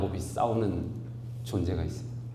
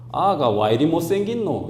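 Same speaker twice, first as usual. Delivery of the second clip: lightly imitating a blunt Gyeongsang auntie; Gyeongsang dialect; 6 semitones higher, 5 dB louder than usual. Pastor mimics Gyeongsang relatives mocking his baby, dialect. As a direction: toss off the jab in Gyeongsang dialect. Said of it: "경상도 출신들은 참 웃긴 게".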